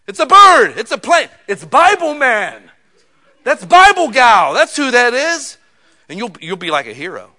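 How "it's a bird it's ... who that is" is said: high, loud, drawn-out announcer cry; superhero announcer. Pretend. Preacher hailing Bible man like Superman, loud and high. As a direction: proclaim it like a Superman newsreel.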